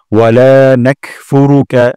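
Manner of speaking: "লা" stretched one alif long; slow recitation, lengthen the লা in "নাকফুরুকা"; drawn-out Arabic recitation. Shown as a demonstration. Teacher showing students the long লা. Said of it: "লাতে এক আলিফ টান".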